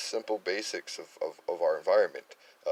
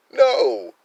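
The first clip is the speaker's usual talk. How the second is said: emphatic no; 12 dB louder than usual. Real, not acted. Answering his own rhetorical question.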